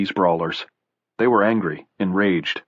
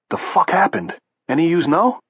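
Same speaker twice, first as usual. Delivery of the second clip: a hint of a rough Boston brawler, higher; lightly voiced bruiser demanding answers; tough Boston brawler; 10 semitones higher than usual. Bald brawler asking the walkers, lightly rough Boston bruiser.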